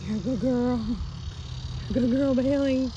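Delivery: high praising pet voice. Real, not acted. Praising the horse.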